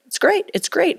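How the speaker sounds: high, light reassurance; imitating a friend politely putting up with it; placating friend imitation.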